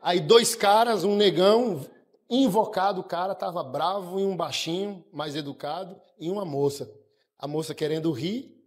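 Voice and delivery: ordinary talk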